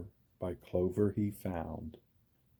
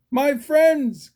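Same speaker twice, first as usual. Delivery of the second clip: much higher, louder joyful cry; cried; 8 dB louder than usual. A narrator's reading. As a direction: cry out in relief. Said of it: "cried the elephant".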